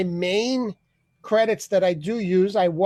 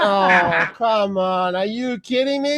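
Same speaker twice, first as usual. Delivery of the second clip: higher, slower, laughing complaint; mock whine; 5 semitones higher, slower than usual. Caller whining to a sleeping friend in a high mock whine. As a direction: whine that you deserve attention.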